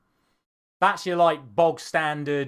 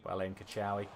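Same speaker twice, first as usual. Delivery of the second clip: ordinary talk, nothing marked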